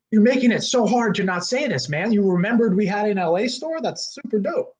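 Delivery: high, gushing disbelief; thrilled fanboy. Retold or imitated. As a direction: gush like a starstruck fan. Thrilled at being remembered.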